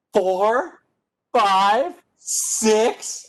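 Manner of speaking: high, bright, delighted counting; super happy. Shown as a demonstration. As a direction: count like you just got great news. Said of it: "I want you to be super happy".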